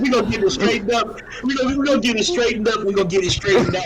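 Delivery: a hint of mocking his pitch; lightly mocking imitation. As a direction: mimic him teasingly. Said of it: "when you said".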